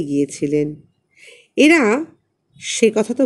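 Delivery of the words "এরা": plain reading voice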